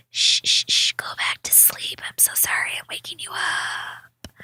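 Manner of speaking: hushed whisper to a sleeping pet; whispering over a crib. Real, not acted. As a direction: whisper softly, don't wake him. Hushing the sleeping cat.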